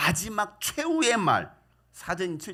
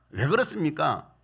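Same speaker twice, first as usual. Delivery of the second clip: usual speaking voice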